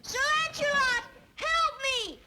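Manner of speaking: high-pitched princess in distress, mock scream; hammed-up damsel's shriek; screamed cry for help. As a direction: call out high for rescue, storybook style. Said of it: "and she screamed".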